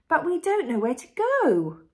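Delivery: put-on high, loud child character; Peppa. A narrator's reading. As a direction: call it out like a little kid. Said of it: "said Peppa".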